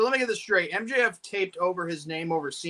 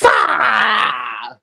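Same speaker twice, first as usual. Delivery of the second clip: shocked shout; 2 semitones higher, 10 dB louder than usual. Real, not acted. Reacting to shocking comment.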